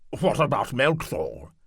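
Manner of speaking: high, loud, eager noble; eager knight lord. Acted. Landenberg asking.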